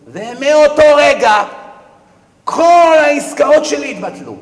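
high, loud and slow; dramatic loud proclamation. Acted. Preacher to congregation proclaiming the rich man's ruin, high, loud and slow. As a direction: declare the downfall slowly at full volume.

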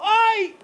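high-pitched "ow" yelp; mock cry of pain. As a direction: yelp as if just slapped. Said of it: "למה צעקת". (צעקת כאב מוצגת, גבוהה)

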